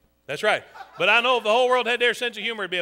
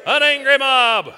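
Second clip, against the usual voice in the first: loud, raised announcer call; big drawn-out stage call; calling out cues; 4 semitones higher, 7 dB louder, much slower than usual. Pretend. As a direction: belt out the cue to the crowd.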